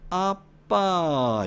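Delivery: very drawn-out Gyeongsang fall from high; slow, high Gyeongsang call; Gyeongsang dialect. Shown as a demonstration. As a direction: stretch it long in Gyeongsang intonation. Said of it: "경상도는".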